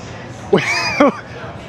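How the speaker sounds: high squeaky laugh; short high giggle. Real, not acted. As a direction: let a laugh burst out high. Laugh after tired joke.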